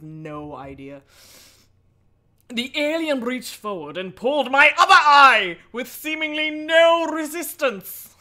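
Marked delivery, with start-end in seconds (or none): 2.5-8.1 higher, louder, slowing at the end; loud horror narration; dramatic story narration. A narrator's reading. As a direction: build to the horrible moment. Reads the horror book aloud.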